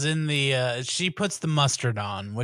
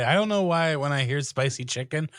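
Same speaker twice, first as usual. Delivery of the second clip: ordinary talk, nothing marked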